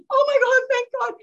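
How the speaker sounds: high, exclaimed mock relief; retold huge relief. Daughter relieved mom kept the secret, exclaimed relief.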